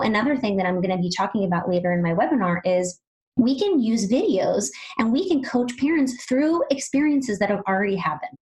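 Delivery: ordinary talk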